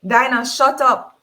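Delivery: an embarrassed employer's loud, angry rebuke; angry order. Acted. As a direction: shut her up, fast and hard. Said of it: "Mister Brown say".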